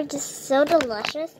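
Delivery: ordinary talk, nothing marked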